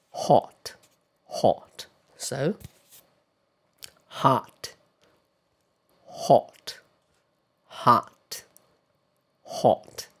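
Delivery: hot with short rounded o; British hot. Shown as a demonstration. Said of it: "in British way, it goes like".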